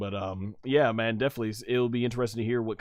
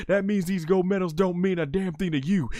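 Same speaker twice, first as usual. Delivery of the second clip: Stone Cold; 7 semitones higher than usual. Retold or imitated. Acts wrestler's accusing promo.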